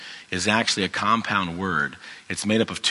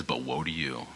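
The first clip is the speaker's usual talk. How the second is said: low, much softer, grave condemnation; condemning tone; 6 semitones lower, 9 dB quieter than usual. Retold or imitated. Jesus turning on the Pharisees, low condemning tone. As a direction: drop down and pronounce judgment quietly. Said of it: "this is a very condemning tone to them".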